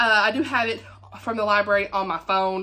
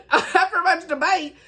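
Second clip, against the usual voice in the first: higher and slow, with a laugh in the voice; 8 semitones higher, 3 dB louder than usual. Real, not acted. Reader restarting the book blurb after a stumble, laughing. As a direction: restart the blurb line while still laughing at yourself.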